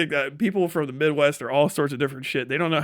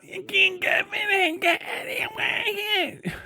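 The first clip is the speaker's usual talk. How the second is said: shrieking laugh, much higher. Real, not acted. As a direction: burst into a high cackling laugh. Loud laugh at New Yorkers.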